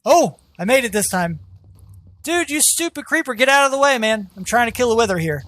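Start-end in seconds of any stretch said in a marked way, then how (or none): 2.2-4.3 higher, exasperated yell at the creeper; annoyed yell. Real, not acted. Gamer yelling at a creeper in his way, exasperated shout. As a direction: snap at it to move, real irritation. Creeper blocks Wither fight.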